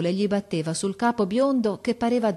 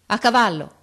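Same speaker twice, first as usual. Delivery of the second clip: loud, raised military order; shouted command; 4 semitones higher, 8 dB louder than usual. A narrator's reading. Officer orders men to mount, shouting. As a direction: bark it to the troop. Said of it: "e gridò".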